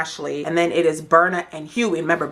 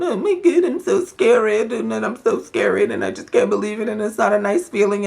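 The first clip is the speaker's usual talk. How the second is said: mock whining; 4 semitones higher than usual. Mimicking a castmate's same old song, mock whining. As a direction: whine it mockingly, as a hammed-up sob story. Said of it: "and all of that whining".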